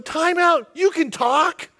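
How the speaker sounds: high, eager rush of ideas; excited. Retold or imitated. Balaam thrilled his donkey talks, high and excited. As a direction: pitch it up like a giddy schemer. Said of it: "Why is he not going".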